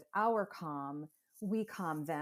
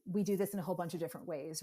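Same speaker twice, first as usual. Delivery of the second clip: ordinary talk, nothing marked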